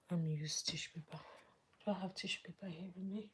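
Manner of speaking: soft unvoiced whisper to herself; whispered aside. Real, not acted. Eyes watering, she whispers to herself looking for tissue, hushed aside. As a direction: whisper it as you hunt for tissue. Eyes tearing up mid-makeup.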